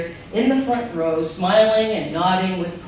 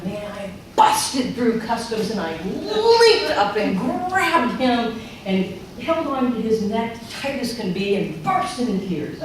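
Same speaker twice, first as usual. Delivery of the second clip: rising excitement; 5 semitones higher, 4 dB louder, slower than usual. Real, not acted. Rushing to dad at customs.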